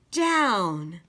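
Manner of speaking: plain reading voice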